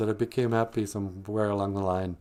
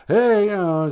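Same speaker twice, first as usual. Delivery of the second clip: mock excited; 11 semitones higher, 9 dB louder than usual. Acting out ladies' emotions.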